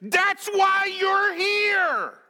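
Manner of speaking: high, loud, drawn-out mock scream; David's frustrated inner scream at Uriah; inward scream. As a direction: scream it out, exasperated, dragging the last words. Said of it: "David is screaming inwardly".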